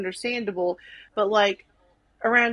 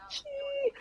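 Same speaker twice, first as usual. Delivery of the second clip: long, very high held "chee", softer volume; drawn-out high island whoop, held back; chee-hoo call; 8 dB quieter than usual. Shown as a demonstration. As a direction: hold the first half of a chee-hoo up high. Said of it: "Like, I was chee-hooing".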